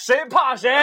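giggling bravado; laughing taunt. Real, not acted. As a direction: cackle out the challenge. Laughing challenge.

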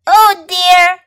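dismayed high, loud exclamation; crying out. A narrator's reading. Raisin after nearly hitting Fox, crying out. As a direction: cry out in dismay. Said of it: "cried his friend Raisin".

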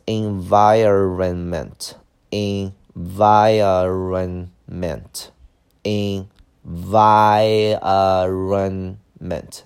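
"environment" very drawn out, en-vi-ron-ment, VI loud; syllable by syllable. Shown as a demonstration. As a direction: stretch each syllable of "environment" for learners. Teacher models word syllable by syllable. (一字一顿、拉长音节读environment)

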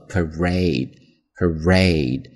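"parade" slowly, weak "pa" and long stressed "RADE"; stress on second syllable. Shown as a demonstration. Teacher demonstrating word stress, second syllable stressed. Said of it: "它的重音在第二个音节上".

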